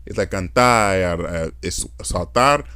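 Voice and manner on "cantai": "cantar" with its final r as an i. Shown as a demonstration. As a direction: end "cantar" in a drawn-out "ai". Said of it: "they say I".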